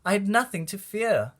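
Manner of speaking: plain reading voice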